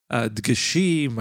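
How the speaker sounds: normal talking voice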